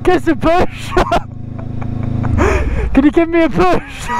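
high laughing plea; laughing call for help. Real, not acted.

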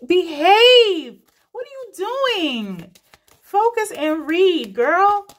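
high, loud mock scold; self-scolding. Real, not acted. Reader scolding herself mid-reading, loud and high. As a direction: snap at yourself to focus. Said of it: "scolding herself mid-reading".

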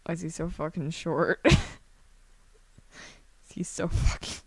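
ordinary talk, nothing marked

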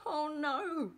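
high, drawn-out storybook yell; animated cry of alarm. A narrator's reading. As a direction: yell out in acted dismay. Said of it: "yelled Zack".

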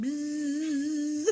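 high buzzing buzz with a laugh; mock dryer buzzer; buzzer noise. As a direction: buzz like a machine alarm. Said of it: "My dryer sings a song to me".

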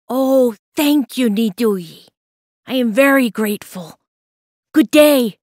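grateful and happy. Acted. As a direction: thank her warmly and gladly. Boy thanks the cat gladly.

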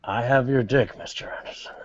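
hushed villain impression; Agent Smith. Agent taunting Mister Anderson, hushed to a whisper. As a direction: drop into a whisper on the name. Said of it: "impression of Agent Smith".

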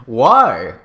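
loud, sudden "why"; startled, punchy question; exclaimed. A narrator's reading. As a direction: exclaim it with a burst of volume. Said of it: "I exclaimed".

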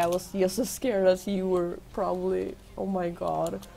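ordinary talk

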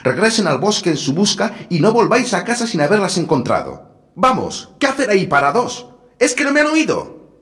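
high, harsh stepmother ordering; wicked stepmother. A narrator's reading. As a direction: bark orders as a cruel stepmother. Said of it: "se enfadó la madrastra".

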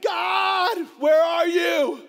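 loud, high cry of despair to God; desperate shout. Acted. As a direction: call out to God as if lost and alone.

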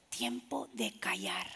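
slow whisper, drawn out; hushed. Shown as a demonstration. Preacher shows the congregation a time to keep silent, whispering. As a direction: drop right down to a whisper.

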